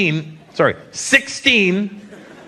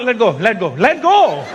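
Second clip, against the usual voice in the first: mock exasperated demand; 2 semitones higher than usual. Mock protest at hand-holding thugs.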